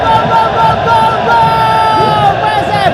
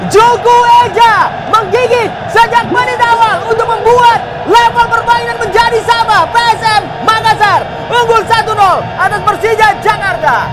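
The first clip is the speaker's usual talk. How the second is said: excited goal shout; 9 semitones higher than usual. Real, not acted. Post-goal shouted commentary.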